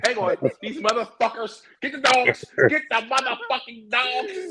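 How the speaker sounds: high-pitched comic holler; mock calling out. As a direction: call for the dogs in a high, hammed-up voice. Joking call to set the dogs.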